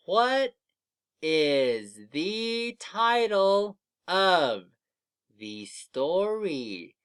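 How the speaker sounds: very slow, word by word with pauses; slow reading aloud. Shown as a demonstration. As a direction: stretch each word for beginning readers. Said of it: "I'm gonna read it to you".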